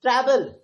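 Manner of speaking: "travel" punched loud on "tra"; loud, emphatic "travel", front-stressed; stress on first syllable. Shown as a demonstration. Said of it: "emphasise कर रहे हैं".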